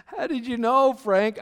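put-on loud, high-pitched astonished drunk; mock amazement. Drunk stranger recognized by the speaker, acted loud amazement. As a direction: imitate a drunk amazed at being found out.

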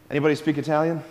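usual speaking voice

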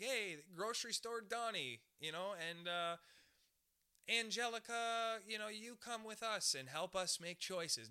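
high, slow mock summons; group of recruiters. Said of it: "group of people that are like".

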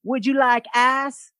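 Texas drawl. Retold or imitated. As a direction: stretch ice into a broad Texas drawl. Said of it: "my introduction to a Texas accent".

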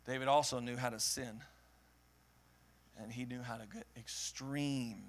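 preacher confessing failure, hushed and low; hushed confession. Acted. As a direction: draw them in close, soft and low.

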